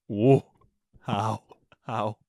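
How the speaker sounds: ordinary talk, nothing marked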